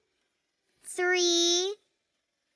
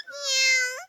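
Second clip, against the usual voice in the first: kitten meow; 8 semitones higher than usual. Acted. Kitten answers in story.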